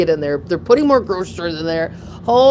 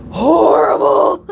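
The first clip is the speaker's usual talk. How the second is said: mock tearful horrible; 8 semitones higher, 5 dB louder, slower than usual. Retold relief after the dog escape.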